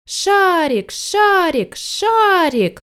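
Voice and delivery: high, drawn-out falling calls; children calling out. A narrator's reading. Children running and calling a name, high calling. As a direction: call out in a high, stretched voice. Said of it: "кричали".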